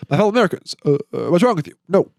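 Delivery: a light take on Obama's measured speech; hint of a presidential address; Barack Obama impression. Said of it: "a really good impression of Barack Obama".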